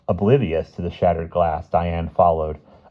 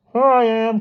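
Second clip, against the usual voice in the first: muffled voice; 6 dB louder than usual. A narrator's reading. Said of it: "came a muffled voice".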